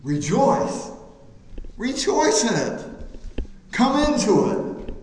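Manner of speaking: high, loud, exultant cry; joyful call. Retold or imitated. Preacher voicing God inviting believers to rejoice, high and loud. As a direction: call them in with joy, ringing out. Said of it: "He said".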